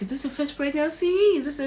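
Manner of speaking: high, lightly voiced friendly staff; a hint of cheerful nurse chatter; chatty doctors. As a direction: mimic the chirpy doctors. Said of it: "we were like".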